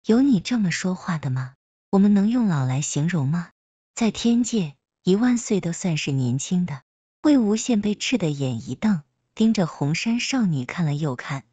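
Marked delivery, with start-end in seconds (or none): none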